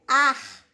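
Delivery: "ar" held out as the strong syllable; stressed syllable. Shown as a demonstration. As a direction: lean firmly on "ar". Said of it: "o som mais forte é".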